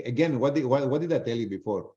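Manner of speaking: usual speaking voice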